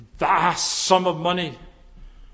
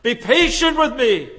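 loud, high plea for patience; crying out; 7 semitones higher, 6 dB louder than usual. Retold or imitated. Preacher voicing the servant's cry to his master, loud and high. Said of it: "He cries".

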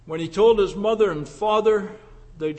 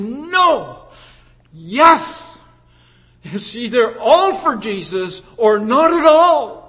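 firm insistence; 2 semitones higher, 6 dB louder, slower than usual. Retold or imitated. Young woman insists on her view.

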